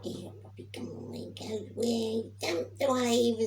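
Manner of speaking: put-on hushed then high, slow baby talk; sing-song baby talk at the seaside. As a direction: coax the baby over the wave. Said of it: "I was going".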